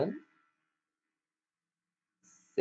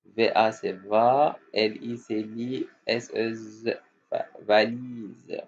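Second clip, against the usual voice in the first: slow spelling. Shown as a demonstration. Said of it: "syllable-by-syllable spelling demo".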